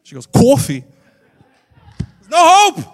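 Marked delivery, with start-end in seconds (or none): 0.3-0.8 high, loud Brooklyn "CAW-fee"; exaggerated Brooklyn vowel, loud and high; thick Brooklyn accent. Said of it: "The thickest Brooklyn accent". 2.0-2.8 high, loud cry of defeat; comic despair at full volume; exasperated outburst. Acted. Exasperated punchline on her accent.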